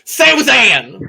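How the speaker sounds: Susan! high, loud with Southern vowels; Southern accent. Shown as a demonstration. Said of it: "I just lean into my southern accent".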